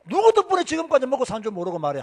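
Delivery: high, loud telling-off; retold angry scolding. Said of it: "내가 그렇게 고함을 질러도요". (높고 큰 소리로 꾸짖기)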